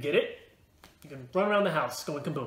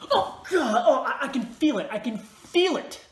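high, loud, stammering outcry; overwhelmed, slowing on the last cry; acted distress; 8 semitones higher, 6 dB louder, slower than usual. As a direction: cry out as if being burned. Acted attack of being incinerated.

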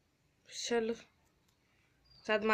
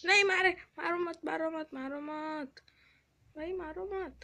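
high-pitched, squealed begging; mock-terrified plea, much higher; panicked pleading; 8 semitones higher than usual. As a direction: beg for your life in a high voice. Begs not to be killed.

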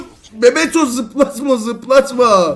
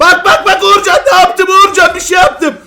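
high, loud, laughing call; excited laughing shout; 7 semitones higher, 6 dB louder than usual. Real, not acted. Laughing, calling teammate to look. (heyecanlı, gülerek bağırma)

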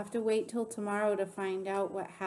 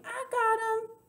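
very high character shout; high, squealed call; slower than usual. A narrator's reading. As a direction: holler it at the top of your range. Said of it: "she kept shouting".

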